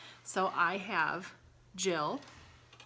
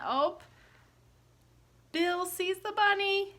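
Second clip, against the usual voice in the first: high, louder, eager storytelling; excited; 11 semitones higher, 5 dB louder than usual. Acted. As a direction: build up to the chase. Dog spots the bunny again.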